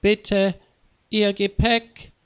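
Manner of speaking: ordinary delivery, nothing marked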